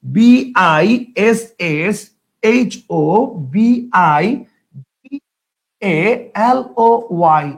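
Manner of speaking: B I S S H O B I A L O Y read out slowly one by one; spelling out letters. Shown as a demonstration. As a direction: spell it letter by letter, slow and deliberate. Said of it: "বাংলিশে লিখে ফেলতেছি". (ধীরে ধীরে এক এক অক্ষর বানান করে পড়া)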